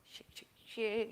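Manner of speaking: ordinary talk, nothing marked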